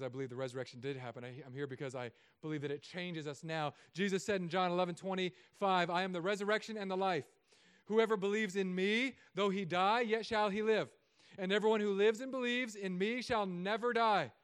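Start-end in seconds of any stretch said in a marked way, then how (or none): 5.9-14.3 scripture read out louder, with weight; scripture proclaimed. Acted. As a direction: proclaim each line of the verse to the church. Quoting Jesus from John eleven.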